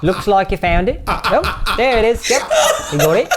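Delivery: mock New Zealand bedroom talk; Kiwi accent. Comic imagining a New Zealander during sex, mock Kiwi accent. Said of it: "the Kiwi accent too".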